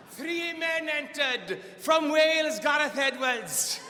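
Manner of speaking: high, drawn-out ring announcer build-up; grand announcer. Acted. Comedian presenting three men entering the lake challenge, grand announcing. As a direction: proclaim each contestant with pomp.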